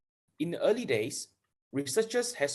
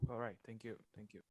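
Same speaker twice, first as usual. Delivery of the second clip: faint voice, far from the mic; speaking well below the mic's reach; barely audible; 15 dB quieter than usual. Real, not acted. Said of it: "Your voice is very low".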